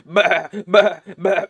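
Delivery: loud bleating sheep. Acted. Sheep bleating as scroll burns.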